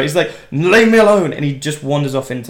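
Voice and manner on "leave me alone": loud, high mock-angry yell; triggered outburst. Triggered vet driving the woman away, loud mock anger. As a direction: cry out as the rattled veteran. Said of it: "He's triggered".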